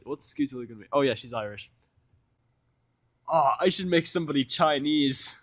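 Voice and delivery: ordinary delivery, nothing marked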